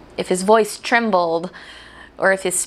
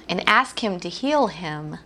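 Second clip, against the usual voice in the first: normal talking voice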